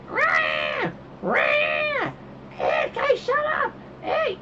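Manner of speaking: very high squealing mock shouts; imitated screeching kids. Dad imitating the racket during the movie, squeaky yelling.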